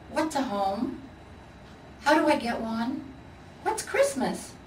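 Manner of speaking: eager little cub asking questions; curious little bear, pitch jumping up. Acted.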